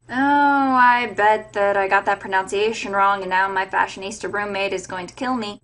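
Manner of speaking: ordinary talk, nothing marked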